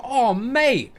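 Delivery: high, loud, delighted cry; super happy "oh, mate". Shown as a demonstration. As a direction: thrill at a friend who came through. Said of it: "I'm super happy 'cause Dave sorted me out".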